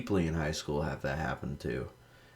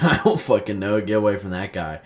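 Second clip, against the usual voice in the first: louder retold blurt; retold exasperation; 3 semitones higher, 7 dB louder than usual. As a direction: blurt it out loudly, retelling your own reply. Retells exasperated reply, louder, laughing.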